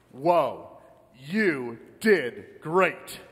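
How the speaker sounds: drawn-out, slow-motion praise; impressed angel. Acted. Angel greeting a new arrival at the pearly gates, impressed. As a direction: stretch each word out in awe.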